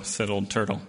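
plain reading voice

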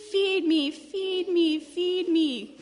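high, loud, pleading duckling; hungry baby cry. Acted. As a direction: wail insistently like a hungry baby. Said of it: "cried the hungry baby duck".

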